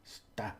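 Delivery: "sta" as a short jab; stabbed syllable. Shown as a demonstration. Teacher describing a little stabbing, stabbed syllable. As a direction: stab "sta" out quickly. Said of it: "like a little stabbing at the end".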